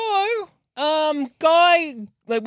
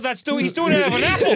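normal talking voice

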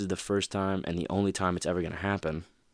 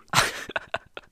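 short laugh; 4 dB louder than usual. Real, not acted. Laughs after LeBron imitation.

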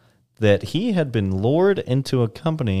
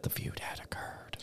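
whisper; 22 dB quieter than usual. Pretend. Whispered aside in story.

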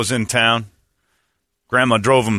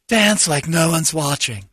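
sappy slogan voice; 4 semitones higher, slower than usual. Acted. Mock sign slogan.

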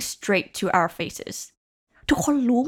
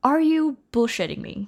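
mock incredulous; 6 semitones higher, much slower than usual. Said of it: "คนเขาชอบพูดว่าแบบ".

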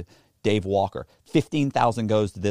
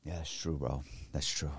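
much softer and lower, flat assent; low calm agreement; 6 semitones lower, 13 dB quieter than usual. Retold or imitated. Tough guy calmly agreeing with his partner, quiet. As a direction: concede it quietly.

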